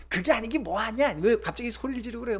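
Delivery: family member arguing back, mildly heated; mock retort. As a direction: snap back in a quarrel. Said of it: "막 이러면 이제 싸움이 더 되니까".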